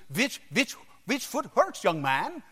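imitated German doctor's accent; thick German accent. German doctor examining a boy, thick German accent. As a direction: question the patient in a mock German accent. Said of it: "He had a thick German accent".

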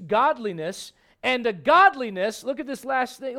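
usual speaking voice

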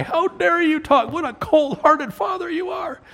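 mock outraged church grandma; indignant grandma. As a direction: huff like an offended grandmother. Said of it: "they gave me the stink eye".

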